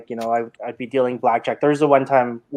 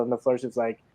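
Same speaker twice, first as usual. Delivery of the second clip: usual speaking voice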